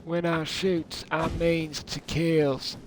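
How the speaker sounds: slow, high southern drawl; put-on southern accent. Streamer playing at gunslinger, slow southern accent. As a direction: draw it out like a Texas gunslinger. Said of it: "Don't know why I'm talking like this".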